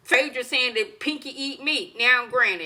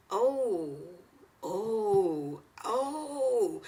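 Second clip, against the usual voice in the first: stunned oh; 5 dB quieter, much slower than usual. Retold or imitated. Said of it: "and I was like".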